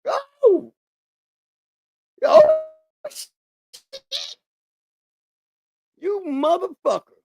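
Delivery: high yelping mock holler of pain; high mock cry of pain. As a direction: yelp it like a hurt man, hammed-up. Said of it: "He made him holler".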